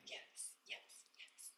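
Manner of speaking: breathy whispered yeses, almost silent. Real, not acted. Coach whispering yes to a client's big dream. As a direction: hush it out in awe.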